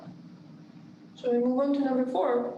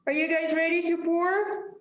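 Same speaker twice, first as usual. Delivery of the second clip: higher-pitched call to the group; raised voice; 6 semitones higher than usual. Real, not acted. Host checking the cuppers are ready to pour, raised voice. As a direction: call out the question, pitch lifted. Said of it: "why am I yelling".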